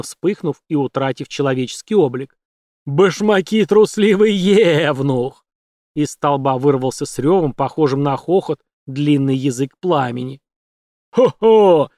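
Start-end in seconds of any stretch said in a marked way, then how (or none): 2.8-5.4 louder, drawn-out jeering spirit; mock him in a slow fiery voice; slow taunting creature voice. Ягов дразнят. 11.1-11.9 loud, high hoot; whooping yell. A narrator's reading. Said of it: "завопил другой".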